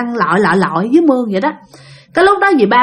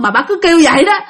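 plain reading voice

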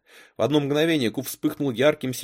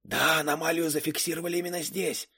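put-on higher-pitched boy's character voice; second boy; 7 semitones higher, 3 dB quieter than usual. A narrator's reading. Second boy reports to his partner outside the window, higher voice. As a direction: voice a young intruder reporting, pitched up. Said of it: "хотя сам говорил громче".

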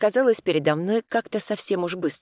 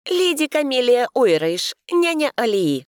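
formal; 8 semitones higher, 5 dB louder than usual. A narrator's reading. Heroine introduces herself formally.

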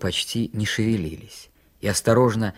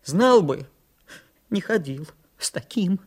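higher, halting, tearful reproach; twitching voice; 7 semitones higher than usual. A narrator's reading. Митька regrets coming with Санька, twitching tearful voice. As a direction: let the voice jerk between words. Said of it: "сказал Митька дёргающимся голосом".

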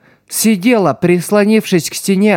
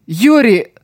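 crying her name; 7 semitones higher than usual. A narrator's reading. Boy shakes her.

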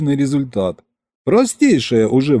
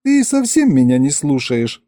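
ordinary delivery, nothing marked

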